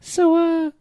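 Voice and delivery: very high-pitched, put-on female caller; high falsetto caller, a little hesitant; imitating woman on phone. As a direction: pitch it way up like a mock girl's voice. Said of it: "is it like this?".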